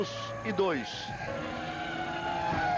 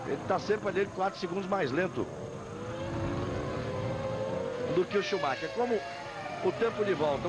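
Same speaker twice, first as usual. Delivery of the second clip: live race play-by-play; brisk broadcast lap-by-lap call; motorsport commentator; 3 semitones higher than usual. Real, not acted.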